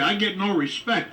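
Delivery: ordinary talk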